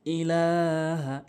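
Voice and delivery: ইলাহা with লা drawn one alif, very slow; stretched ilaha. Shown as a demonstration. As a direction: pull the লা long in ইলাহা. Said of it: "এভাবে উচ্চারণ করবেন". (ইলাহা লাম এক আলিফ টেনে)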